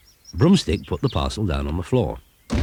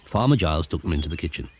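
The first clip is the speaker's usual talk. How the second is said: usual speaking voice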